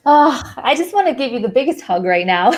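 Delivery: warm laugh through the words; tender, laughing thanks. Real, not acted. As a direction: laugh with affection as you say it. Host moved, laughing warmly.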